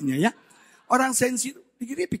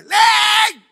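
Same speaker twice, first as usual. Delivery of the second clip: sudden high, loud yell; 12 semitones higher, 14 dB louder than usual. Retold or imitated. Husband re-enacts yelling for his wife, loud shout. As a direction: holler the name, imitating a bossy husband. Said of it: "orang ngomong teriak sama dia".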